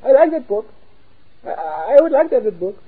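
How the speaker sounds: high, small, meek voice; a tiny hopeful voice peeking out; high, small, put-on soul voice; timid little soul. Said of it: "the soul going".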